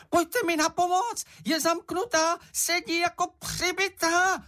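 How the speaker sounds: very high squeaky creature calling for help; excited Čenich. A narrator's reading. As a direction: squeal it, all worked up. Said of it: "vykřikl najednou Čenich". (rozrušené vysoké pištění)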